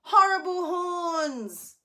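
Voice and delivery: Horrible horns drawn out loud and high; scary emphasis. Shown as a demonstration. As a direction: stretch out Horrible horns menacingly. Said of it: "Make some horns like this".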